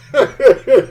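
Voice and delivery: loud high burst of laughter. Real, not acted. Remembering tough grandma, loud laugh. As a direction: crack up suddenly and loud.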